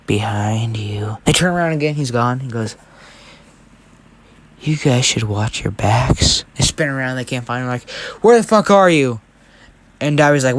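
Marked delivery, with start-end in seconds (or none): 0.1-1.2 slow, drawn-out ghostly whisper; creepy whisper. Acted. Unseen Deku taunts from behind. 4.6-6.4 low, slow warning from the shadows; eerie taunt. Acted. Unseen Deku taunts them. 8.2-9.2 louder, higher acted outburst; frustrated demand. Villain searching for vanished Deku.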